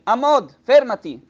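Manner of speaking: alto e forte, comando secco del popolo; ordine gridato. Acted. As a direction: grida l'alt come una folla che interrompe. (ordine perentorio a voce alta)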